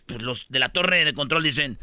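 ordinary delivery

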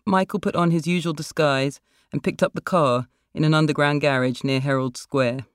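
plain reading voice

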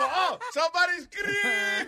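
high, very slow, drawn-out call through laughter; mock DJ call. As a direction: ham up the DJ line while cracking up. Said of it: "Y el tipo".

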